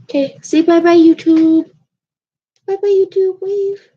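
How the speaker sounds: put-on high, loud sing-song baby talk; cat's voice. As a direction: voice the cat waving bye. Cat waving to viewers.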